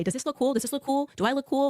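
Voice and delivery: mock kid brother asking if he looks cool; eager Charlie. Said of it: "he would text me a picture and say".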